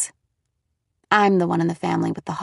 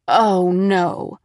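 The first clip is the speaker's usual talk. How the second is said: groaning; 5 dB louder than usual. A narrator's reading. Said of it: "I groaned".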